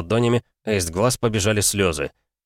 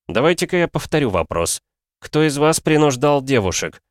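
stern interrogation; 7 semitones higher than usual. Acted. Hero interrogates the youths.

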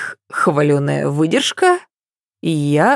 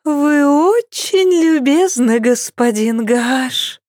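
high, slow languorous purr; languid drawl; 10 semitones higher, slower than usual. A narrator's reading. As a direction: drawl it, flirting lazily. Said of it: "томно протянула Сесиль". (томно протягивая слова)

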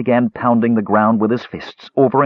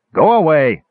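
angry shout, higher and louder, from behind a door; old elf yelling at someone pounding on his door; angry call; 9 semitones higher, 7 dB louder than usual. Acted. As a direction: snap it out like an old man woken at night. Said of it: "called out in an angry voice".

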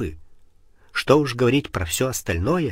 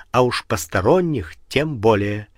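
ordinary delivery

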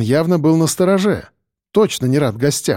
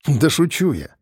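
ordinary delivery, nothing marked

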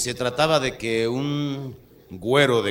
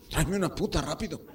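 higher, mildly urgent grandpa request; abuelo con urgencia; 3 semitones higher than usual. Acted. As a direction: ask urgently but without raising the volume. (petición urgente de abuelo, sin gritar)

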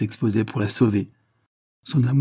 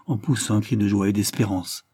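plain reading voice

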